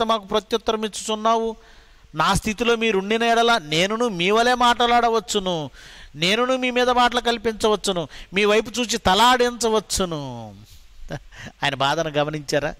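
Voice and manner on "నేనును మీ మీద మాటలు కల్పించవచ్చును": ordinary talk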